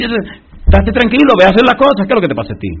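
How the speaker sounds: high, loud, mock-impatient scolding; exasperated reassurance. God cuts off Moses' doubts.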